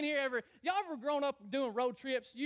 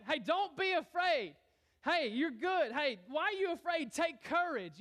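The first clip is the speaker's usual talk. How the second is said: raised, higher-pitched call of encouragement; acted calling out, pitched up; crying out; 5 semitones higher than usual. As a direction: call out to rally frightened friends. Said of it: "he cries out to him".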